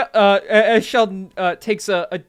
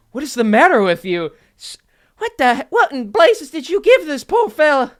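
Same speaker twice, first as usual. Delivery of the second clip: louder, higher indignant scolding; 7 semitones higher, 6 dB louder than usual. Acted. Waitress scolds the bartender over the drink. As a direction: tear into the bartender.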